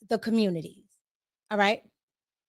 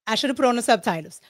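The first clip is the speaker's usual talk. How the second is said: ordinary talk, nothing marked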